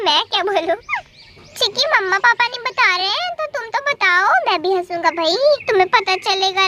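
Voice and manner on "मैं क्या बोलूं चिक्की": ordinary delivery, nothing marked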